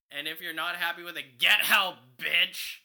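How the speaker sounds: loud high yell; shouting. Real, not acted. Mock shouting the advice.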